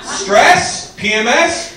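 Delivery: ordinary delivery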